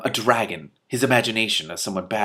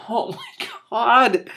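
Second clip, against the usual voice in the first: higher, slow and drawn out; stunned oh my god; 13 semitones higher, 4 dB louder, much slower than usual. Real, not acted. Reader reacting to the dragon vanishing, slow amazement. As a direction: react in disbelief.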